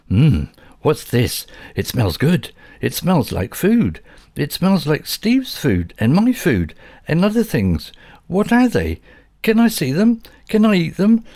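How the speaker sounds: high, loud curious dog voice; an eager little dog nosing around. A narrator's reading. Dog Bobby wonders what is in the dishwasher, high and eager.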